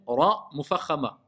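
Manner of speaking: ordinary delivery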